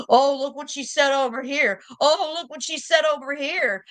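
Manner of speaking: mock her eager tattling tone; mocking gossip. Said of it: "she's following me around".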